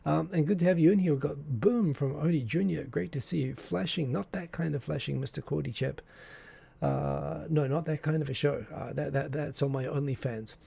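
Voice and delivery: normal talking voice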